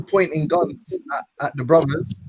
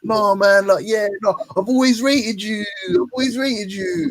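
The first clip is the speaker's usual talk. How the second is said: mock fawning voice; 7 semitones higher than usual. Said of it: "And he's like".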